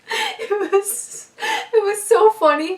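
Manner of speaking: high, squeaky burst of giggles; real laughter breaking into the words; laughing. Real, not acted. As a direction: crack up while saying it. Cracks up at the memory.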